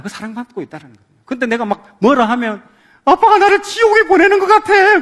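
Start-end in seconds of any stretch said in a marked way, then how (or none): 3.1-5.0 put-on very high, loud child whine; mock wailing child. Scolded child complaining to dad, hammed-up high wail. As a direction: overblown kid's complaint, hammed-up. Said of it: "막 이런 아이들 있잖아요".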